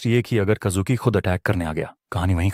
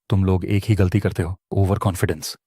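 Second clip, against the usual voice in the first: ordinary delivery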